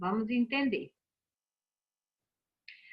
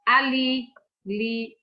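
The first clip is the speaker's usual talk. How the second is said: "Ali" said loudly, then "li" alone; stress on LI; 4 dB louder, much slower than usual. Shown as a demonstration. Teacher giving an example of final stress, emphatic "li" in "Ali". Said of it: "a sílaba mais forte".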